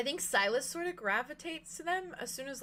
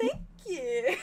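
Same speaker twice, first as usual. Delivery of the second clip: giggly thanks; 4 semitones higher, 3 dB louder than usual. Acted. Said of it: "I was just like".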